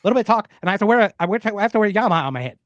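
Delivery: high and fast chipmunk voice; squeaky rapid chipmunk chatter. Pretend. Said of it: "You just have to talk fast, sound like a chipmunk".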